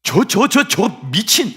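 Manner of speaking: loud, high furious outburst, stammering 저 저 저; angry shout. Acted. Pastor voicing angry bystanders, a loud furious outburst. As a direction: yell like a furious onlooker. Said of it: "전문용어로 쓰면 그죠?".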